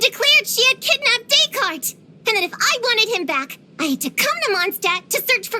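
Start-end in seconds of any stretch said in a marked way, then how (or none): 0.0-3.6 indignant. Acted. Telling of Descartes's kidnapping, indignant. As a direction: pitch climbing in outrage. Indignant account of rival's wickedness.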